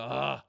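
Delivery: loud, exasperated "ugh"; dismayed groan. Retold or imitated. Retold dismayed reaction to the outfit.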